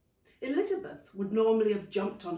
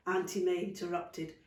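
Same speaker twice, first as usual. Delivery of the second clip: ordinary delivery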